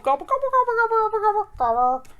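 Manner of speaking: high squeaky gobbling like a turkey; playful falsetto turkey call; turkey gobble. Pretend.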